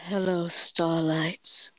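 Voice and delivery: low, very slow, calm character voice; calm Precious Gem. Shown as a demonstration. Actor demonstrates the line that gets her into character, calm and slow. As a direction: draw it out low and serene. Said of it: "obviously, you gotta be calm".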